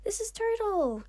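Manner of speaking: high, loud excited introduction; bubbly, high-pitched excitement. A narrator's reading. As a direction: announce it excitedly, voice way up. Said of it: "with an excited voice".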